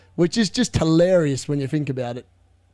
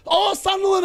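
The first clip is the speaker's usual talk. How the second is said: excited shout; 13 semitones higher than usual. Real, not acted. Streamer watching a chance against Liverpool, excited shout. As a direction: shout it much higher, real excitement at the chance. Live reaction to a near goal.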